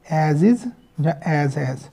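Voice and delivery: very slow, spaced-out words; slow demo reading. Shown as a demonstration. Teacher reading the outline aloud to students, slowly. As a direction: read it out slowly for the class. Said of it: "हम क्या पढ़ा करेंगे".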